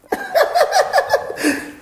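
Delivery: loud, very high cackle; gleeful laugh. Acted. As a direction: laugh out in giddy delight. Director delighted after securing a date.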